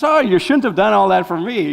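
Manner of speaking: lightly voiced schoolgirl, playfully coy; mock-coy teasing girl. High school girl teasing a stranger, playfully coy. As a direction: tease him like the flowers are yours. Girl adds to her tease.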